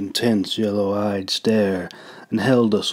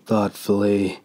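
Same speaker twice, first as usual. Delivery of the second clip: ordinary delivery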